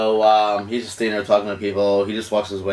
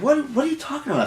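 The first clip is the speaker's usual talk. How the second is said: mock denial; faster than usual. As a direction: play the accused boyfriend, pitched way up. Retold denial when accused of kissing.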